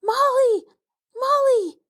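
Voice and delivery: high, loud, desperate calling out; terrified scream. A narrator's reading. Lost girl screaming for her missing cousin, terrified. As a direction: shriek her name into the dark woods. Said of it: "scream at the top of her voice".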